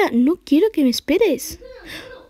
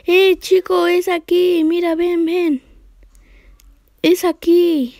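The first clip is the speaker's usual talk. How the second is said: higher coaxing call; 6 semitones higher, 3 dB louder, slower than usual. Real, not acted. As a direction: lure him over, pitch up. Luring character in game.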